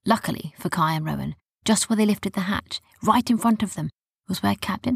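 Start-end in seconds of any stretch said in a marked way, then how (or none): none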